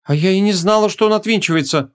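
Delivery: high, mildly puzzled voice; surprised. Acted. Young woman learning that it unscrews, surprised. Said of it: "удивилась она".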